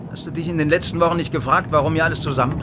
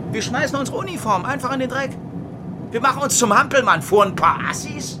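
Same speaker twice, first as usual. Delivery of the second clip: higher pitched, bitter outrage building; indignant rant; 7 semitones higher than usual. Acted. Stasi officer venting to Doberschütz, rising indignation. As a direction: spit out the humiliation of surrender. Stasi man rants angrily.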